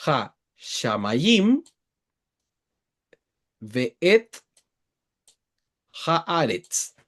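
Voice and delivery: "Ha Shamayim, Ve'et Haaretz" muy despacio, sílaba a sílaba; lectura hebrea lenta. Shown as a demonstration. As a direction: leer "Ha Shamayim", "Ve'et", "Haaretz" lento, con la h aspirada. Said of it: "acá dice después". (deletrear al oído "Ha Shamayim Ve'et Haaretz" en hebreo)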